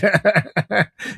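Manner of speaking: bright burst of laughter; real laugh. Real, not acted. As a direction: let the laugh spill out.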